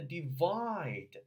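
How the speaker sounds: "divide" with a drawn-out, full "eye" vowel; long diphthong. Shown as a demonstration. Coach showing the double vowel, long diphthong. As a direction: stretch the second syllable of "divide" slowly. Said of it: "又特别的长".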